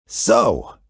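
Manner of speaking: very low, rumbling monster voice; deep monster Gabe. A narrator's reading. Gabe the monster greets the kid, very low and rumbling. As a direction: speak from deep under the bed like a friendly beast.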